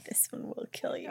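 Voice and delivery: soft, breathless whisper. Acted. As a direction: whisper it like a dark secret. Dramatic whispered line from the book.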